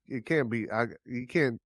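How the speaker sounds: normal talking voice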